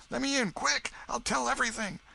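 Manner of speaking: put-on high, urgent boy's plea; frightened boy. Huck begs the Welshman at the door, frightened and high. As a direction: beg to be let in fast.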